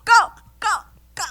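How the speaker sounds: high, loud chant of go; cheering a friend on; excited. Real, not acted. Hyping friend to go next.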